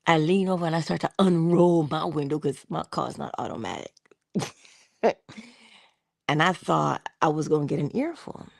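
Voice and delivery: ordinary talk, nothing marked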